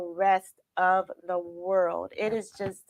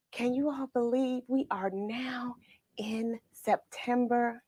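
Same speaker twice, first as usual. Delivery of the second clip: hushed, breathy marvel; whisper; 8 dB quieter than usual. Real, not acted. Host marvelling to listeners that it is September, whispered. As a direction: whisper it like a secret.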